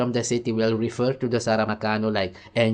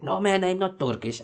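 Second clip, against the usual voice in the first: much higher, imitated objection; retold protest; 9 semitones higher than usual. Kurdish acquaintance correcting the speaker, high-pitched mock protest. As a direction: act out the man rejecting the label. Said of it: "He said".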